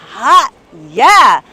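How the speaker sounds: loud delighted cheer; excited whoop. Real, not acted. Realtor cheering her client's budget, loud whoop. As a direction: whoop it up at the big number.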